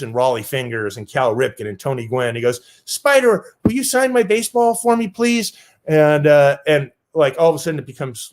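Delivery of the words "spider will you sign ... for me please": put-on higher, sweet small-boy ask; little kid pleading. Seven-year-old asking Spider to sign, small pleading voice.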